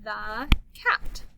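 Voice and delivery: slow, drawn-out reading aloud; slow modeled reading. Shown as a demonstration. Teacher to young reader, reading slowly. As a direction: model each word slowly for the child. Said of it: "let you go first".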